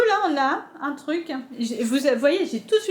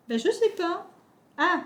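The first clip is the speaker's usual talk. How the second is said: usual speaking voice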